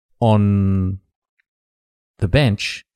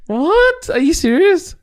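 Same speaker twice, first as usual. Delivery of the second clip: high, loud disbelief; surprised; 4 dB louder, slower than usual. Real, not acted. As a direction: shriek in astonished disbelief. Shocked at the joke.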